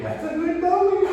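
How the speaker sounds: squeaky happy praise voice; high-pitched praise. Real, not acted. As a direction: lift way up to reward him. Praising the dog.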